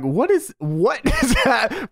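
high, laughing what is that; baffled disbelief. Retold or imitated. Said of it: "And I'm like".

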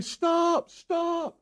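high-pitched drawn-out cry, imitating the owner; mock yelling. Owner running at driver across pasture, mock yelling. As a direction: stretch each word high like a distant holler.

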